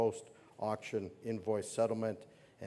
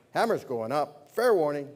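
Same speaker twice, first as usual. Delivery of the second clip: loud warning; 4 semitones higher, 9 dB louder than usual. Acted. Auctioneer warns before hammer.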